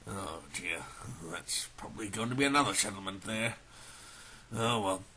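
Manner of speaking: low, soft muttered aside; quiet, low "oh dear" to himself; dismayed aside. Acted. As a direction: mutter it to yourself, resigned. Dismayed aside after offending people.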